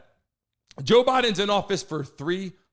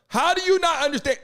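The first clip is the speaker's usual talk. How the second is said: exasperated; 8 semitones higher than usual. Real, not acted. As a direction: throw up your hands in disbelief. Exasperated rant.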